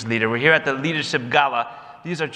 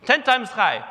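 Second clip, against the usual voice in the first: much higher, coaxing taunt; teasing push; 11 semitones higher than usual. Retold or imitated. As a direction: egg him on playfully. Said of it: "Rosie turns to the fellow".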